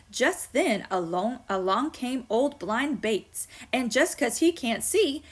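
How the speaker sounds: lively storybook reading to the class; poem reading. Acted. Teacher reading a funny poem to her students, lively. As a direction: read it like a fun poem for kids. Reads a Silverstein poem aloud.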